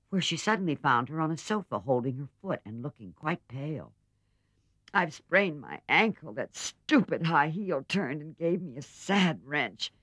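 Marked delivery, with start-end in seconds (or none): 4.9-9.9 in pain. Acted. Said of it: "rocking to and fro in pain".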